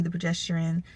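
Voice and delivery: normal talking voice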